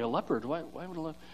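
soft, low, confused aside; puzzled mock listener. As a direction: mutter it low, like a baffled listener. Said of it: "Some people are like".

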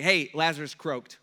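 tactless, casual news-breaking; offhand and crude, at full voice; blunt announcement. Acted. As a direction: blurt out the bad news without cushioning. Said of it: "What he's trying not to say is like".

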